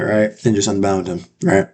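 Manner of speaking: normal talking voice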